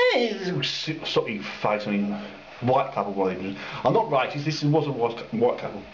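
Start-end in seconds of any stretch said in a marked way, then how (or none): none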